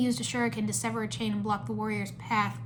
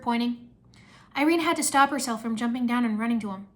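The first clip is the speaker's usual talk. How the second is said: usual speaking voice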